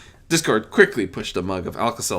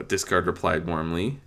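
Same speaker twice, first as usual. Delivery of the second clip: ordinary delivery, nothing marked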